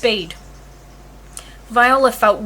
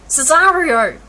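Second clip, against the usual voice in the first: higher, louder call; thundering; 4 semitones higher, 5 dB louder, slower than usual. A narrator's reading. Orsino thundering at Cesario arm in arm with Olivia, louder. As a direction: thunder his name. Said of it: "Orsino thundered".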